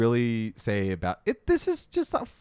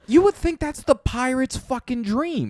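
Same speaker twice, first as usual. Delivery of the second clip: excited; 11 semitones higher than usual. Real, not acted. Excited pirate dream.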